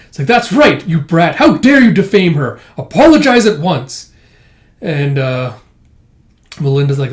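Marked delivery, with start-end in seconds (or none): 0.3-4.1 raised, loud acted scolding; indignant demand. Scolding a brat for defaming Melinda, indignant. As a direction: scold with affronted authority.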